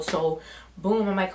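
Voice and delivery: ordinary talk, nothing marked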